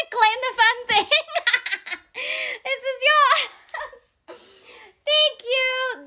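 high, helpless laughing through the words; words stretched and squeaky with real laughter. Real, not acted. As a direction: crack up while talking. Cracks up over own confused accent.

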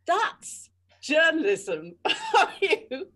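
slow wry line through laughter; laughing aside. Real, not acted. Thinking about bitchy colleagues, laughing slowly. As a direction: laugh it out, resigned.

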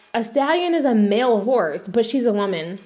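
normal talking voice

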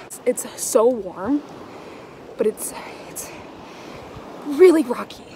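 anxious chatter while wading over sharp rocks; scared. Real, not acted. Wading nervously over rocks, talking to camera, scared. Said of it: "I feel so scared right now".

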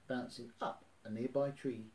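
ordinary delivery

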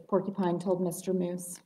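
plain reading voice

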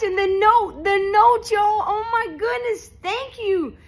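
high, near-tearful gratitude; choked up. Real, not acted. Reading the sender's kind note, high and near tears. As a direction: voice lifting as the tears come close. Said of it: "You almost made me cry".

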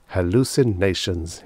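ordinary delivery, nothing marked

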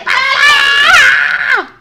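high, loud drawn-out yell; full-throated wail; long exasperated scream. Real, not acted. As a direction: scream it out in frustration. Screams at mum while playing.

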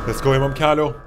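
loud excited let's go; cheer. Real, not acted. Gamer cheering to the shopkeeper, loud and hyped. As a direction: hype it up loud.

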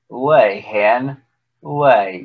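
ordinary delivery, nothing marked

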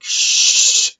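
long, forceful "shh"; exaggerated loud hushing; loud group shush. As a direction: shush them hard, imitating a whole classroom. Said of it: "everyone shushes the kid".

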